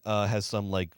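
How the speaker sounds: ordinary delivery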